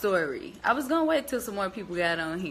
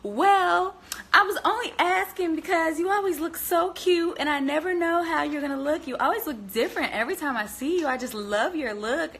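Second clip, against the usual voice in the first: imitating the lady; 9 semitones higher than usual. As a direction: gush like the coworker complimenting her. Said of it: "she goes".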